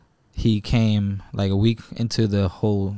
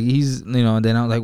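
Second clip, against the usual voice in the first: ordinary delivery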